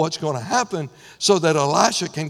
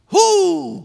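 high, loud preacher's whoop; exultant whoop; 13 semitones higher, 5 dB louder than usual. Real, not acted. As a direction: let out a joyful whoop.

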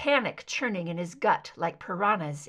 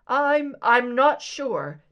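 flustered; 4 semitones higher, 7 dB louder, slower than usual. Acted. Caller flustered, stammering.

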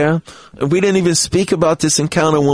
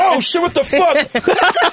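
high, slow laughing shock; mock startled yell; startled high yelp; 13 semitones higher, much slower than usual. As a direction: shriek it out in surprise, laughing. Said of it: "Jay was like".

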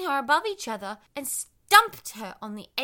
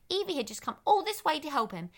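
ordinary delivery

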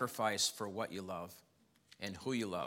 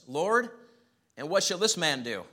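Peter's question; 6 semitones higher, 6 dB louder than usual. Acted. Peter questions Jesus in scripture.

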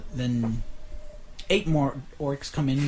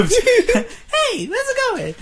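dopey cartoon bellow; 4 dB louder, much slower than usual. Shown as a demonstration.

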